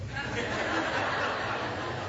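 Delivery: high airy laugh; breathy laugh. Real, not acted. Pastor laughing at his own ticket joke.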